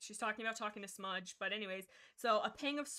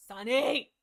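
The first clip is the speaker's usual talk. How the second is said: louder, rising call of the name; delighted shout-out; excited call; 2 semitones higher, 11 dB louder than usual. Real, not acted. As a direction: cheer her name out. Excitedly crediting a listener.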